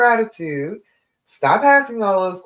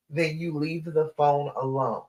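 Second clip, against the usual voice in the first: ordinary delivery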